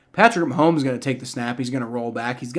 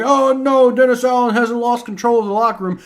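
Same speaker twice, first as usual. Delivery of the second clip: high, loud sarcastic imitation of the general manager; snide quote of Mickey Loomis; mocking the GM; 7 semitones higher, 4 dB louder than usual. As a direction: parody him brushing it off, pitched up. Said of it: "Mickey Loomis is out here like".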